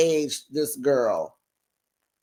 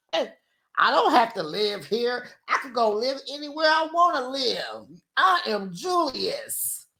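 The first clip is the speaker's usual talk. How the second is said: hammed-up arrogant Julius; 8 semitones higher than usual. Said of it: "Julius's boast in the argument".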